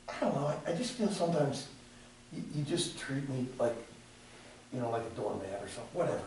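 low, quiet, sulky reply from a friend; soft, low imitation of a hurt friend; hurt mumble. Voicing Cooper's hurt reply.

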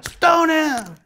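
high, loud yell; angry crowd shout. Acted. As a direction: shout the order. The crowd turns on Jesus.

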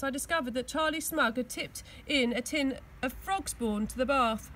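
ordinary delivery

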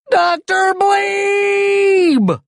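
very high, loud, stretched-out scream; long high-pitched holler; desperate call. A narrator's reading. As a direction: wail the name for help, holding the last word. Calling for help at museum.

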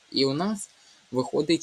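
ordinary talk, nothing marked

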